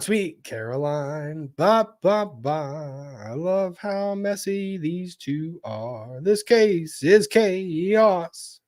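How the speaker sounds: slow, drawn-out southern gentleman reading; old southern gentleman. Pretend. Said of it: "your Southern gentleman voice".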